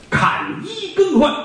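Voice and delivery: slow, high stretched-out call; a yamen official's slow, sung-out cry; drawn-out court call. Acted. Storyteller calling the court to session, slow and drawn out.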